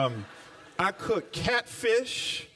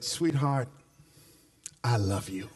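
tender declaration; 8 semitones lower than usual. Retold or imitated.

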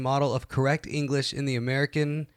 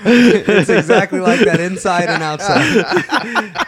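laughing; 6 semitones higher, 9 dB louder, much slower than usual. Real, not acted. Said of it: "laughing at own reading".

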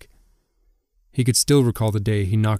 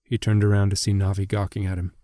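usual speaking voice

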